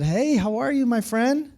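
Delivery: high, rising friendly hello; friendly greeting. Retold or imitated. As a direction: greet him in a lifted, singsong pitch. Retold friendly greeting to man.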